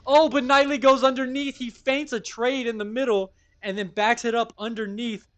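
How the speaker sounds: loud, high-pitched live call; real hype, voice shooting up; excited play-by-play. Real, not acted. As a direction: burst in as the play suddenly turns. Live call of sudden big play.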